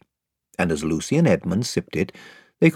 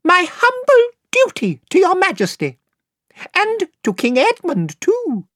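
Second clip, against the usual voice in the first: very high, piping, courtly little voice; shrill piping mouse; 4 dB louder than usual. Acted. Reepicheep greeting Queen Lucy in a piping voice. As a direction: pipe it like a tiny gallant knight. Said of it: "in his shrill, piping voice".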